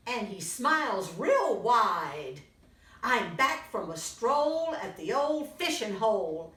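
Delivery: put-on lively read-aloud for little kids; storybook reading. A narrator's reading. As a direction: tell it brightly to a young audience. Reads picture book to children.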